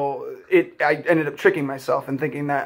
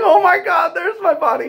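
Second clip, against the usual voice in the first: much higher, astonished, retold; amazed gasp; 14 semitones higher, 4 dB louder than usual. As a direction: gasp it out as if seeing your own body from above. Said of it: "and I'm like".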